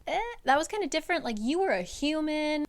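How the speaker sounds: fast, high, dismissive voice; high mock High Lords. High Lords brushing off Feyre's plea, high and fast. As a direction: toss it off high and quick.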